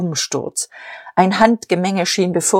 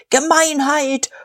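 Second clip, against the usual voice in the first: high, drawn-out crowd outcry; a whole club roaring as one; roared in outrage; 9 semitones higher, slower than usual. A narrator's reading. Veterans' club heckling in outrage, roared as one. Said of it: "brüllte wie ein Mann".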